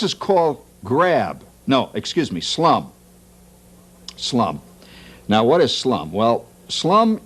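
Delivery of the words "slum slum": ordinary talk, nothing marked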